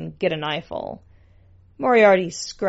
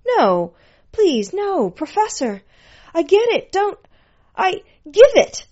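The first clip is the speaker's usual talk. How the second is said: very high, desperate whine; high-pitched broken-up begging; whining; 11 semitones higher, 7 dB louder than usual. A narrator's reading. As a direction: plead loudly at the sudden loss. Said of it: "whined at the loss".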